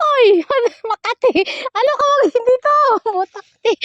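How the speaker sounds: loud, very high yelps between laughs; real ticklish squealing. Real, not acted. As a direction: squeal it out, itchy and giggly. Ticklish outcry between laughing fits. (matinis na tili habang nakikiliti)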